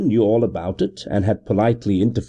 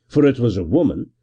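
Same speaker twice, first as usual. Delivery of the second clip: ordinary delivery, nothing marked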